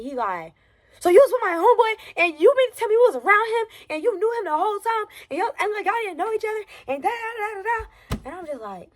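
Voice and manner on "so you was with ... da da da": high-pitched mocking rant, running accusations together; mock angry yelling. Friend imitating the man who confronted her, mock high yelling. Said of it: "He yelling at me".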